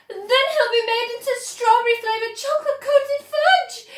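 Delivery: very high, loud scream; horrified mother screaming at Wonka. A narrator's reading. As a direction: shriek it in horror. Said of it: "screamed Missus Gloop".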